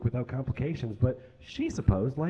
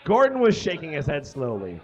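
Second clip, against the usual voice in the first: normal talking voice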